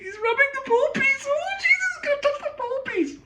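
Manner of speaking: very high, shrieking mock horror; mock panicked snob. Mocking fussy commenters.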